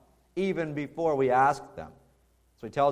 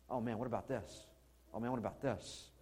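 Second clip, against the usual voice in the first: very soft, low worried murmur; quiet, low night-time worrying; lying awake fretting; 5 semitones lower, 14 dB quieter than usual. Acted. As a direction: fret to yourself in the dark, hushed. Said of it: "laying there in the tent one night".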